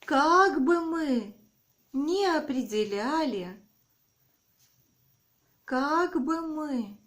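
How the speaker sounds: dictating. Shown as a demonstration. Dictation, slow with repeats.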